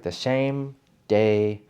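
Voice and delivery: "same" said with SH for the S, slowly; demonstrated lisp. Shown as a demonstration. Showing viewers what a lisp is, "same" lisped with an SH. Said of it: "instead of saying S, you say S H".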